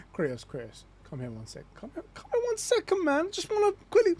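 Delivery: creepy Odin. Acted. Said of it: "He's just like".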